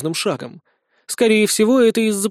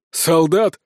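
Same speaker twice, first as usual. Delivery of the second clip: gruff, annoyed question; mildly put-on rough irritation; displeased bandit chief. A narrator's reading. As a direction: grumble it as a brigand boss. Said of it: "недовольно спросил Грок".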